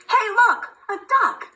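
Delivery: high, bright-eyed discovery of a duck; high excited read-aloud for a baby; excited storybook voice. Acted. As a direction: point it out to a toddler with wide-eyed delight. Reading picture book to baby.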